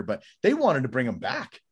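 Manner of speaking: ordinary talk, nothing marked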